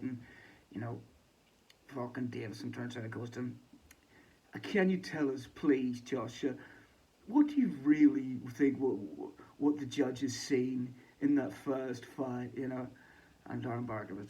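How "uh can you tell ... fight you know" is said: mock interviewer. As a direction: drag out the question pompously. Imitating Davison asking Josh.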